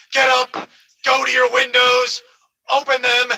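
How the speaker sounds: ordinary delivery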